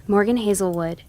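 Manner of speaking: plain reading voice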